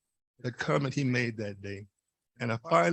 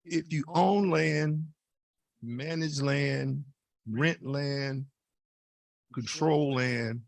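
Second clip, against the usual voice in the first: slow deliberate list; much slower than usual. Real, not acted. Slow listed appeal to audience.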